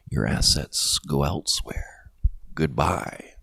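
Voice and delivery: hushed, slow, dropped to a whisper; ominous whisper. Acted. Warning viewers their assets vanish, in a slow whisper. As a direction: let it slip out like a warning secret.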